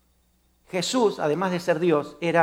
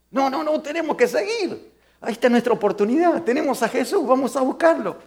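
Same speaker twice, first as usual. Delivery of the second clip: urgent insistence; 5 semitones higher than usual. Acted. Blind man urging to keep going.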